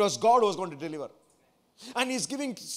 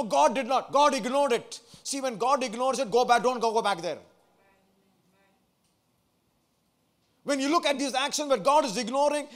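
loud, raised pulpit voice; forceful preaching; 4 semitones higher, 5 dB louder than usual. Acted. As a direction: drive it home to the congregation, high and loud.

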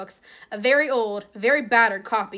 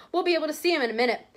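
higher and fast, eager; Mrs Weasley; 6 semitones higher, much faster than usual. Acted. Mother hurries the family along, high and quick. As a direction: rush it out, excited to meet him. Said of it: "said Missus Weasley".

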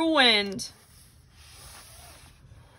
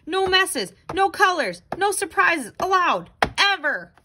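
rules cried out higher in a panic; panicked rules; 7 semitones higher than usual. Acted. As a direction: chant the rules in alarm.

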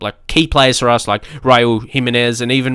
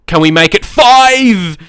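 louder, higher, drawn-out hopeful call; willing the next goal in; excited anticipation; 8 semitones higher, 5 dB louder, slower than usual. Real, not acted. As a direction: build up to a big stretched FIVE. Urging on a fifth goal.

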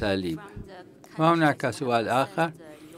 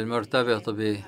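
usual speaking voice

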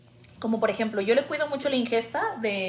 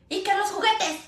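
higher, quick nagging call; mock nagging call; 9 semitones higher than usual. Mom telling son about toys, higher nagging call. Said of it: "hablo como la burrita burrona".

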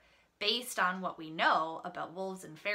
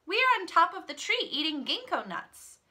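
high, raised storybook call; 9 semitones higher, 6 dB louder than usual. A narrator's reading. Shang answers the wolf from the tree, calling out. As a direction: call it down loudly from the treetop. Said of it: "Shang called out".

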